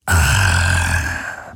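tiefes, genießerisches Ah; genüsslicher tiefer Seufzer. A narrator's reading. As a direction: ein langes, gieriges Ausatmen. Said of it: "machte das fast unsichtbare Wesen". (tiefes genüssliches Ah)

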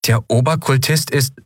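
can't quite believe it's a machine; a touch of stunned surprise; baffled. Acted. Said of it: "fragte sie verdutzt".

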